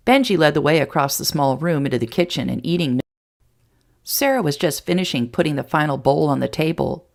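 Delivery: ordinary delivery, nothing marked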